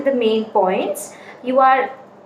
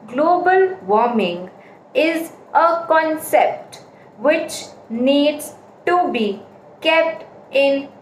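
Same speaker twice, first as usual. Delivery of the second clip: Global warming, then a pause, then is a concept which needs very slowly; bad choppy pauses; slower than usual. Shown as a demonstration. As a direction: chop the line with slow pauses. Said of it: "I was speaking like this".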